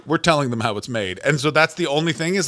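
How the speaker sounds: normal talking voice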